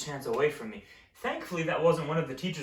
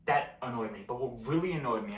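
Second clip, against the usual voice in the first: usual speaking voice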